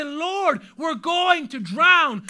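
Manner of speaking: frightened disciples crying to Jesus; fearful plea. Retold or imitated. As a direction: beg as if drowning. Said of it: "the disciples come full of fear".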